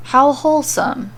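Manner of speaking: ordinary delivery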